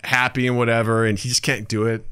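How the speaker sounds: normal talking voice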